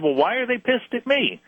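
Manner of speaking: retold astonished why; incredulous question. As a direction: ask it high in disbelief. Pressing the caller in shock.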